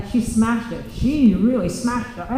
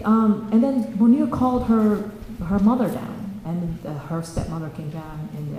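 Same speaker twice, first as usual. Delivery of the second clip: plain reading voice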